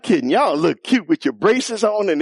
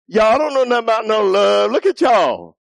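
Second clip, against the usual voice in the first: mock teen scoffing; 7 semitones higher, 3 dB louder than usual. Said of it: "you say".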